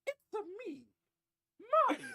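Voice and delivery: high cheerful Italian plumber voice; Mario voice. Acted. Small creature greets the big man in a high Mario voice. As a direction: bounce it out like the plumber hero. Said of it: "the small creature says".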